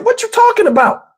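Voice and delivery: high, incredulous question; baffled. Retold or imitated. He answers girlfriend's demand, high and baffled. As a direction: throw it up high in disbelief. Confused reply to her.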